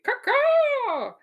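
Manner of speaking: loud, high crow caw. Acted. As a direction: squawk it like a crow. Crow call after "pros being fed.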